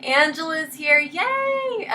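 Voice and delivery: high-pitched happy announcement; real giddy little yay, way up high; excited cheer. Real, not acted. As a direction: squeal it with delight. Cheering a friend's arrival.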